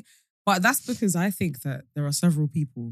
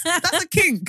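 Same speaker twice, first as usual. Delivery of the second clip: normal talking voice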